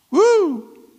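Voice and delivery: high, loud whoop of joy; joyful whoop. Acted. Preacher whooping at the Lord's shout, high and loud. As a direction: let out a happy church whoop.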